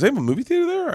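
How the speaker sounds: high-pitched questioning; mock-suspicious, high query; retold incredulous dad. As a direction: imitate a dad who can't believe it, voice way up. Retelling dad's suspicious question.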